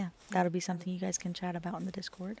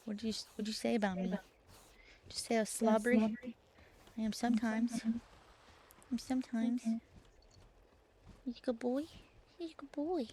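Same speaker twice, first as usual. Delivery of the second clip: voicing her cat; 3 semitones higher, 4 dB quieter, faster than usual. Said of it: "He's like".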